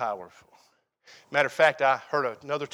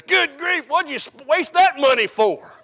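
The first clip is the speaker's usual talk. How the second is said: high, loud exasperated scold; wife's exasperated scold; 11 semitones higher, 9 dB louder than usual. Retold or imitated. Wife scolding husband over Valentine's gifts, high exasperated scold. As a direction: scold him for wasting money, pitched up high. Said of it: "that's about exactly how she said it".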